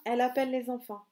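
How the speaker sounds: ordinary delivery, nothing marked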